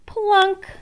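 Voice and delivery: a high, bright plunk; high plunk sound. A narrator's reading. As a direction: pop the word like a drop. Sound word in story.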